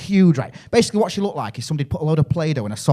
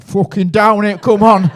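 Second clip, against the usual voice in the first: loud, higher British lad egging on; lager lad shout; 7 semitones higher, 8 dB louder, much slower than usual. Retold or imitated. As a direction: bellow it like a drunk Brit on holiday. British lads downing tequila.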